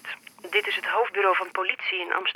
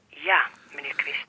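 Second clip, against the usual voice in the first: whispered confirmation; 5 semitones lower than usual. Acted. Police officer confirms death.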